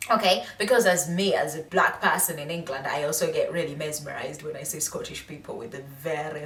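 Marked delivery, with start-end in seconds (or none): none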